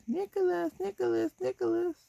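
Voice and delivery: high-pitched imitation of a child calling the cat; pestering little kid. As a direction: chant the name like a kid pestering a pet. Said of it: "Just picture a little kid".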